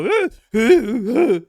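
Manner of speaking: very high, loud bleating eh sounds; mocking bleat. Mocking communist sympathizers with high bleating noises. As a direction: bleat like a helpless fool. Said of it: "That's what you sound like".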